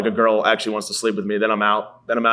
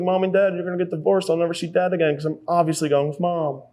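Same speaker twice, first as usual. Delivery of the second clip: put-on high, pleading little kid voice; a scared kid begging his parents; 7 semitones higher than usual. Boy begging parents in the car, high pleading kid voice. Child voice crying in back seat.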